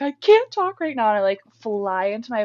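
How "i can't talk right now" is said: louder and higher, choked-up refusal; upset outburst. Retold or imitated. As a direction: blurt it out in distress. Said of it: "And I'm like".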